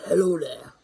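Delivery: loud comic put-on voice; funny put-on accent. Answering a phone scammer to wind him up, loud funny accent. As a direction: greet in a silly, booming accent. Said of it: "I just put on an accent".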